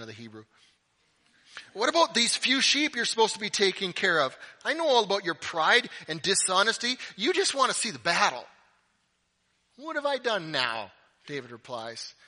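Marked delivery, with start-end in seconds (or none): 1.7-8.5 high, loud accusing rant; angry older brother. Acted. Eliab accusing David of deserting his sheep, angry. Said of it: "he gets angry". 9.8-10.9 raised, loud younger-brother complaint; exasperated protest. Acted. David answering his angry older brother, exasperated.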